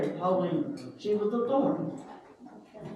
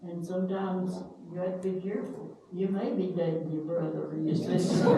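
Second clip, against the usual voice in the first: ordinary talk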